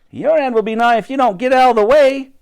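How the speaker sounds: high, loud shouted warning; Elsie. A narrator's reading. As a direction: shout at someone blocking the road. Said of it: "shouted Elsie".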